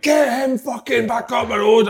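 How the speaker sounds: high, loud, drawn-out mock yell; acted shout. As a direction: holler it like someone shouting across a pitch. Retelling yells from the pitch.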